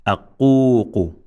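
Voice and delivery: "aququ" with the middle u held long; extended vowel. Shown as a demonstration. Teacher modelling extension, "aququ" with a long middle vowel. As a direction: draw out the middle of "aququ", short last syllable. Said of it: "here we say".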